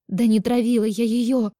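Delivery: high, loud exasperated protest; desperate anger. A narrator's reading. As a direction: burst out in angry despair. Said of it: "Отчаяние и злость вырвались наружу". (с отчаянием и злостью)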